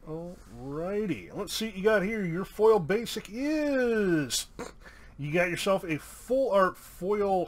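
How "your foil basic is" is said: high, drawn-out IS; suspenseful reveal. Real, not acted. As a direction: build up to the card with a stretched last word. Suspenseful card reveal to patron.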